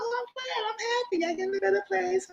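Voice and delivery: high mocking falsetto of fake joy; mock cheery voice. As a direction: put on a sugary high voice to mock her.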